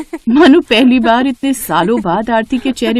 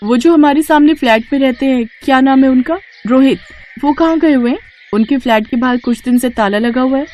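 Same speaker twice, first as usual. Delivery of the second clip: higher, raised call to the guard; young woman calling out; 4 semitones higher than usual. Acted. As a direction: call him over, then ask eagerly. Aarti calls the guard.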